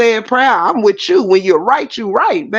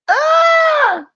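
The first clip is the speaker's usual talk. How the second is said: high sudden yelp of joy; a short excited scream; sudden shout. Real, not acted. Excited outburst on live stream.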